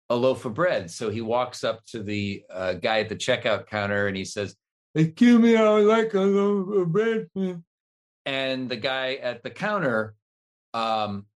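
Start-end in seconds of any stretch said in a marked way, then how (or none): 4.9-7.6 put-on high, loud little-kid voice, words mushy; slurred childlike plea; kid with speech impediment. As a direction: act the kid who can't quite say it. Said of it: "the kid has a speech impediment".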